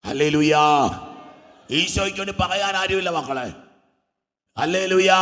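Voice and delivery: loud calls to the congregation; forceful praise cries and appeal; impassioned preaching. Real, not acted. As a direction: proclaim at full volume.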